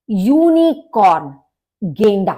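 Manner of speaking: ordinary talk, nothing marked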